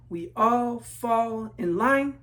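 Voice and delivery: slow, even, marching chant; slow refrain. A narrator's reading. Refrain of the marching class, slow chant. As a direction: drag it out in step like a marching line.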